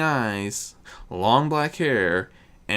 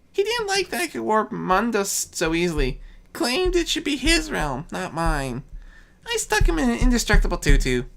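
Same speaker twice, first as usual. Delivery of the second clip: Discord as a boastful youth; 10 semitones higher than usual. Acted. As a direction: brag it like a mischievous young god. Discord's voice, held.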